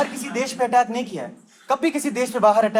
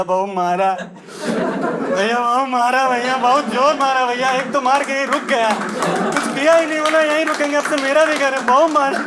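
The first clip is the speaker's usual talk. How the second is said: louder, higher whiny lament; mock wailing; 3 semitones higher, 4 dB louder, slower than usual. Villager complains to brother that invaders hit hard, whining. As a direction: moan like a beaten villager.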